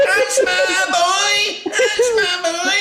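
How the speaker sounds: high, slow, loud laughing cheer; cheering mum. Retold or imitated. Mum cheers her son from the audience, loud and high. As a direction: scream it like a proud mum.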